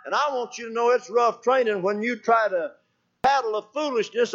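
rising sermon cadence, ending in hallelujah; emphatic preaching. Real, not acted. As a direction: preach the point home, pitch lifted. Preacher rises to his point.

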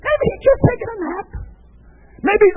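high jeering call; mocking cry, falling away; Elijah taunting. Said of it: "out comes Elijah".

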